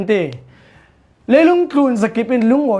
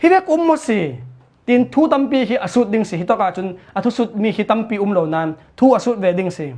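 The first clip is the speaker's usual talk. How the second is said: ordinary talk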